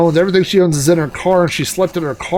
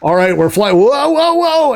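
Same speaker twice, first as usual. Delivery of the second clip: mock alarmed pilot; 9 semitones higher, 3 dB louder than usual. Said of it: "the helicopter pilot's like".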